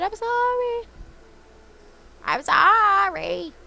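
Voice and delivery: high, slow mock apology; silly cutesy sorry; squeaky apology. Real, not acted. Apologising to fainted Murkrow.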